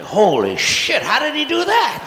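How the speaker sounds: high, loud startled cry; shocked little cat yelp; amazed cat. Acted. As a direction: jolt of sudden disbelief. Cat voiced amazed mid-jump.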